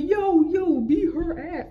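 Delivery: high-pitched hyped-up egging on; instigating schoolkids. Retold or imitated. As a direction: imitate kids goading a fight. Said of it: "they would jump on that and be like".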